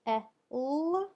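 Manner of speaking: E, L as separate sounds, short eh then held ull; E, L said by sound, not by letter name. Shown as a demonstration. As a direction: sound out E, L loudly as eh ... ulll. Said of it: "I hear the".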